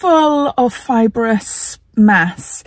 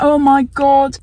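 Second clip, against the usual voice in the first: slow, higher, taken aback; stunned; 6 semitones higher, slower than usual. Retold or imitated. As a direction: react in stunned disbelief. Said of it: "And I'm like".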